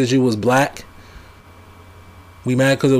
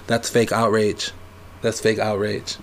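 low, clipped Trump imitation; mock Trump catchphrase; Donald Trump impression; 3 semitones lower, 4 dB quieter than usual. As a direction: do a quick Trump voice. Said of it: "That's my best Donald Trump impression".

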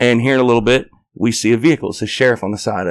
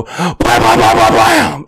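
gunshot noises; 4 dB louder, slower than usual. Retold or imitated. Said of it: "load the clip and go".

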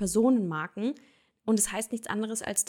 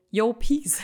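loud, cool street-style sign-off; casual slang greeting; 2 semitones higher, 6 dB louder than usual. Shown as a demonstration. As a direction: toss it off like a laid-back homie. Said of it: "oder schreibst du". (lässig-cooler Slang-Gruß, laut)

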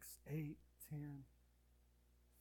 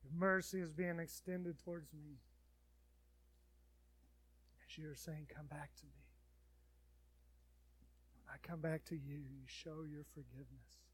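praying; 4 dB louder than usual. Acted. Leads a prayer.

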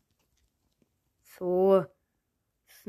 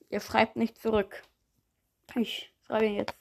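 usual speaking voice

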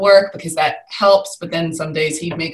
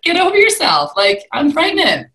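much higher, exasperated snap; exasperated retort; 10 semitones higher, 4 dB louder than usual. Retold or imitated. Pregnant woman telling off whoever called her fat, exasperated. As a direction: scoff it off like it's obvious. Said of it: "but I was like".

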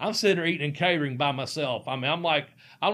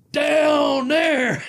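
high, loud punchline bark; hammed-up shouted down there; mock shout; 10 semitones higher, 8 dB louder than usual. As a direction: yell it like a wrestling taunt. Scott Hall's crotch-chop punchline.

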